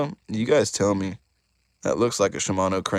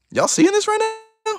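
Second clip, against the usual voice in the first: excited high disbelief; 6 dB louder than usual. Real, not acted. Amazed at glittering crankbait.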